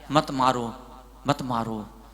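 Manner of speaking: low, soft plea for mercy; gentle restraint. Retold or imitated. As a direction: imitate a guru quietly calming a mob. Voices injured guru restraining the crowd.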